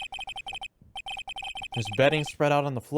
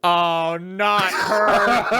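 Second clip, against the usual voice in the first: dismayed Phoenix voice; 5 semitones higher, 6 dB louder, much slower than usual. Acted. Dreading a character's arrival.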